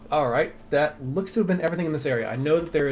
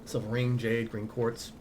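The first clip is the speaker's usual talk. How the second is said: ordinary talk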